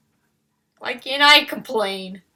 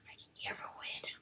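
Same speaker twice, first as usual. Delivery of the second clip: defeated whisper; 25 dB quieter, faster than usual. Real, not acted. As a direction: whisper it, beaten and hopeless. Venting frustration at drawing tool.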